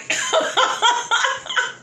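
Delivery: high wheezing laugh; breathy high laugh. Real, not acted. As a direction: laugh without voice. Laugh after joke.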